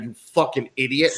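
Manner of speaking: normal talking voice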